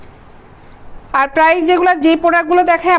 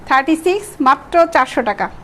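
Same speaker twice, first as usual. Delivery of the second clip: usual speaking voice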